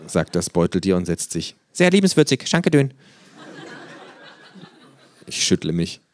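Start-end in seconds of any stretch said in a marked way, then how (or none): 1.7-2.9 hohe, verspielte Känguru-Stimme. A narrator's reading. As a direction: frech und hoch als Känguru. Känguru verdreht Wörter. (freche, hohe Känguru-Stimme)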